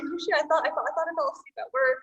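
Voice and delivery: panicked sleeper thinking he dozed off at work; mock panic. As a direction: blurt it like you overslept at work. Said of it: "They're like".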